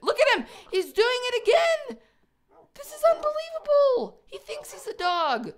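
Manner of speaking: very high, loud disbelief; disbelieving excitement. Real, not acted. Watching the pig act like a dog, very high and loud. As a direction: exclaim in amazed delight.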